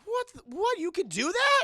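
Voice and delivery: high, loud disbelief; hammed-up incredulous squeal; mock astonishment. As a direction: blurt it out like an amazed kid. Acted astonished boy.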